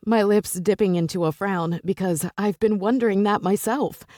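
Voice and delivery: usual speaking voice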